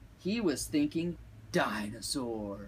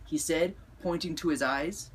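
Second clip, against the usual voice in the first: usual speaking voice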